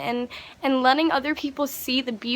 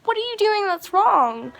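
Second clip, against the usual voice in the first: higher-pitched, impassioned challenge; passionately mad; 7 semitones higher, 4 dB louder than usual. Retold or imitated. Walker challenges two people who said sorry, passionately mad. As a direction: ask it heatedly, pitch jumping up. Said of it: "got a little bit passionately mad".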